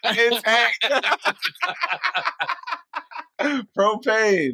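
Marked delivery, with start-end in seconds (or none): 0.8-3.7 high squealing burst of laughter; cracking up, pitched way up; big laugh. Real, not acted. Laughing at Hank Hill joke.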